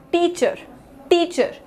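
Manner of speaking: "टीचर" as टी, tiny pause, चर; pause between syllables. Shown as a demonstration. Teacher shows small pauses in one word, pause between syllables. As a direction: split "टीचर" into two clear beats. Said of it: "ये possess है आपको".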